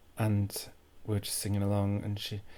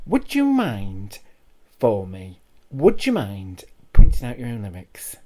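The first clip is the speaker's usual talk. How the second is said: passive-aggressive; 14 dB louder than usual. Retold or imitated. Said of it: "it was quite passive aggressive how she was".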